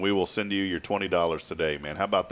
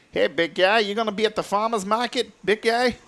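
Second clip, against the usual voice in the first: high-pitched imitation Connecticut accent; 13 semitones higher than usual. Host mimics dad's friend from Connecticut, in accent. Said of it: "It's like a Connecticut accent".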